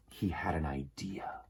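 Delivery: soft, secretive unvoiced whisper; hushed whisper to the children. A narrator's reading. Storyteller's secret reveal.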